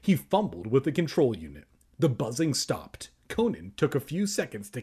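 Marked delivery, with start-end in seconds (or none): none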